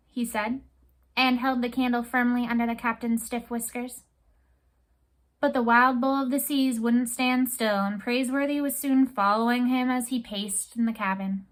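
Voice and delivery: usual speaking voice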